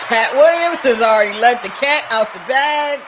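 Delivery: loud, emphatic shout at the audience. Real, not acted. Shouting a warning to Jamaicans about Hollywood, loud. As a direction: bellow the warning out.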